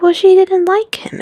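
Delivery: high, bright defensive answer; high defensive reply. Retold or imitated. Young man explaining the sister to Lizzie, high defense. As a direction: say it high as an excuse.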